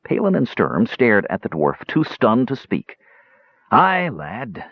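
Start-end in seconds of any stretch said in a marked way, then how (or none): 3.7-4.6 lightly sighing admission; resigned, understated sigh; heavy sigh. A narrator's reading. Dwarf admits the loss to Tannen, sighing. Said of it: "Dugan sighed heavily".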